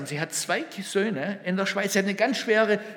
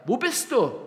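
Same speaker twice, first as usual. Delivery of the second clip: high, searching call; 6 semitones higher, 3 dB louder than usual. Acted. Preacher voices God calling for the listener, calling out. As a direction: call out for someone missing. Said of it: "Er ruft nach dir".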